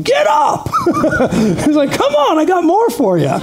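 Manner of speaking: high laughing shout of a boxer over his opponent; Ali taunting. Retold or imitated. Ali yelling at the knocked-down opponent, high laughing taunt. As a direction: yell down at the fallen fighter with glee. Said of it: "he's yelling to him".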